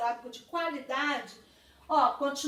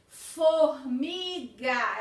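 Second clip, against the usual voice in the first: drawn out slowly, syllable by syllable, a touch louder; stretched syllables; 2 semitones lower, 6 dB louder, much slower than usual. Shown as a demonstration. Teacher sounding out Formiga for class, slow stretched syllables. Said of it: "ela fala mais".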